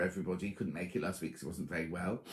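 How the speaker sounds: ordinary talk, nothing marked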